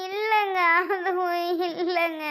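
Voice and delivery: weeping while trying to speak; crying. Acted. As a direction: answer through sobs. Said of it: "இவ எதுக்காக அழுதுட்டு இருக்கா".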